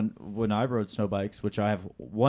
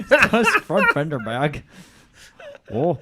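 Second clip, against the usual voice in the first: Canadian accent; 5 semitones higher, 7 dB louder than usual. Shown as a demonstration. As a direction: drag it out Canadian style. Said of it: "Can you say this in a Canadian accent".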